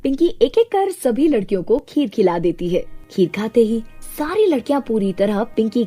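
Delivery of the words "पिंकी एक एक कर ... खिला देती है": ordinary delivery, nothing marked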